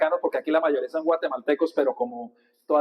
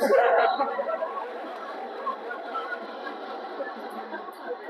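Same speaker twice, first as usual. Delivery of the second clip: airy wheezing laugh at his own joke; real laughter after the punchline; long breathy laugh; 8 semitones higher, 6 dB quieter than usual. Real, not acted. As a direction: laugh hard, breathy and high.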